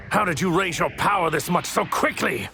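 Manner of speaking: high, strained battle cry; outraged disbelief. Acted. Villain facing a Saiyan's sudden power, outraged disbelief.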